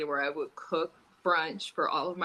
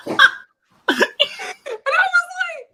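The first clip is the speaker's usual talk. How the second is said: high, louder words broken by laughter; laughing; 13 dB louder than usual. Real, not acted. Telling friends about the breakup, laughing hard. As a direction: push it out through a laugh.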